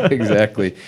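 laughing out loud. Real, not acted. Laughing at the joke.

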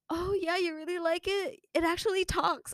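a hint of a high, eager giver; proud giver. The giver showing off the talking pillow, lightly voiced and eager. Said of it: "She was like".

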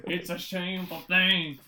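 loud, badly faked Irish accent; mock Irish accent. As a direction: put on a hammed-up Irish lilt. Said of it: "That's Irish".

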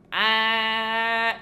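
loud honk in imitation of a goose. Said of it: "Can you do an impression of a goose?".

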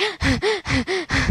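high wheezy gasping; mock asthmatic gasps; imitated asthma attack. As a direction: mimic gasping for air. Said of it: "Her asthma's going off. She's like".

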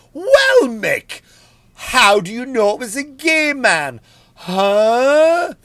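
high, loud mocking challenge; heckler challenge. Imagined heckler confronts the comic, high and loud.

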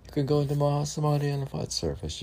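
mock excited reading. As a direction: fire it off high and fast like a breathless believer.

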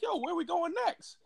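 high, soft clueless eager ask; drunk eager. Retold or imitated. Drunk guy asking friends where next, high and eager. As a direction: pitch it up like a drunk ready to keep partying. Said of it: "I was just like".

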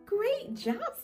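ordinary talk, nothing marked

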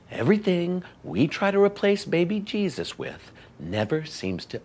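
reluctant, measured repeat with gaps between phrases; slowly. Acted.

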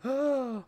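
high-pitched gasping "ah"; mock gasp of someone hit by a cleaver; imitated dying cry. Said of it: "then him going".

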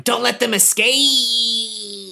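high, slow, straining gnome command; a little gnome yelling don't let them get away; gnome shouting orders. Acted. Gnome ordering capture of the boys.

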